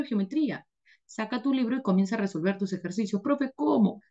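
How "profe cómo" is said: high, mock-tearful pleading; whiny crying student. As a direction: play a heartbroken student begging the teacher. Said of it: "Llorando y con lágrimas". (alumno lloroso y agudo, llanto fingido)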